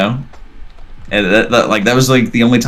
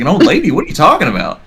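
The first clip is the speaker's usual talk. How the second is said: disbelieving; 9 semitones higher, faster than usual. Retold or imitated. Homeowner hearing of an old lady ghost, disbelieving. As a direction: demand it in disbelief.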